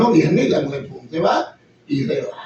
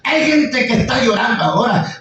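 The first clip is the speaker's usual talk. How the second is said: ordinary delivery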